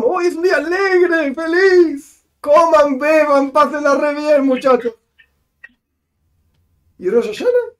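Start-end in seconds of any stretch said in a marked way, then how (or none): 0.0-4.9 much higher, gleeful cry of celebration; joyful Nehemia. Acted. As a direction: throw the words up like a cheering host. Voicing Nehemia urging celebration.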